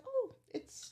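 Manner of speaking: high-pitched retold gasp of recognition; a soft, high "oh" of surprise; pleasantly surprised. Guest retelling seeing who followed her, pleasantly surprised. Startled at recognising a person online.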